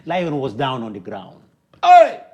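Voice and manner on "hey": sudden loud, high cry; exclaimed shout. Acted. Storyteller crows as another challenger falls, shouting. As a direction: shout it out over the fallen challenger. Triumphant cry after a win.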